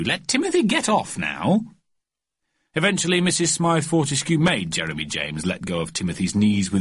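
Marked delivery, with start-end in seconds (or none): 0.0-1.6 higher coaxing mother voice; lady voice. A narrator's reading. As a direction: gently insist like a mother. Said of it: "said Missus Smythe Fortescue".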